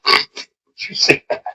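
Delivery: slow, soft church whisper; gossiping whisper. Retold or imitated. Churchgoer whispering slowly about a parent twisting a kid's ear. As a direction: hush it like a nosy churchgoer four pews back. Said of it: "you hear four pews behind you go".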